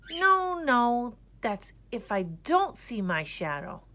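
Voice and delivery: high, loud no no; boy catching his mix-up; catching a mistake. A narrator's reading. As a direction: correct yourself in alarm. Boy corrects himself.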